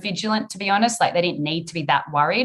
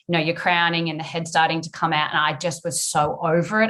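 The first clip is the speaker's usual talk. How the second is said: ordinary talk, nothing marked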